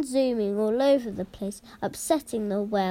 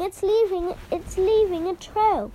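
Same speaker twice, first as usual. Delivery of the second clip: high, loud excited call; excited children; 9 semitones higher, 4 dB louder than usual. A narrator's reading. Children spotting the snail in class, excited high call. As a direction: shout it like thrilled kids pointing.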